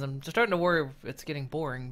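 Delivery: usual speaking voice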